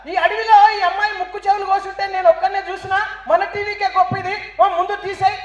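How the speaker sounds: high, loud eager newsman; excited TV crew member. Acted. TV man excited about the forest scoop, high and loud. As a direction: sound thrilled at the scoop. Cameraman voiced in joke.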